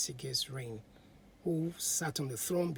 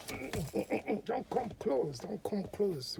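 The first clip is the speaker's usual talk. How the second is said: muffled, higher voice behind a cloth; muffled plea to keep away; through a handkerchief; 6 semitones higher, slower than usual. A narrator's reading. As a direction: speak through a hanky held to the nose.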